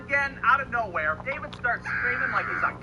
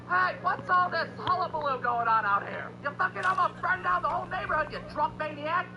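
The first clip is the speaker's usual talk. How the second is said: mock-angry neighbor, pitched high; irate neighbor; 7 semitones higher than usual. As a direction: scold them in a high, indignant voice. Irate neighbor confronts them after fire.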